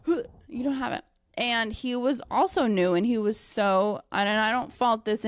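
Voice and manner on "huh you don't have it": higher, quick tentative try; a hint of the attempt, said fast; trying the move. Trying the wrist escape on the new guy. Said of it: "I was trying to, like".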